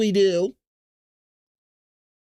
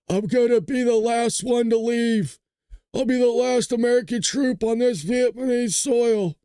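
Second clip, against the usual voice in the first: a light, slow, higher heroic movie-soldier voice; Mel Gibson impression; 5 semitones higher than usual. Comparing the ambassador to a war film hero, light heroic voice. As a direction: lightly play the noble last man out. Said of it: "he's Mel Gibson from We Are Soldiers".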